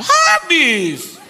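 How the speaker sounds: high, drawn-out yell; mock maid shout. Maid answers her employer about food, mock shout. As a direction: holler the answer back, comically overblown.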